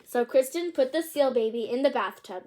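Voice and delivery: ordinary delivery, nothing marked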